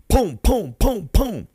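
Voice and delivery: loud, high mock gunshots. Storyteller imitating the shots, loud booms. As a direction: fire off the booms. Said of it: "and then you just hear".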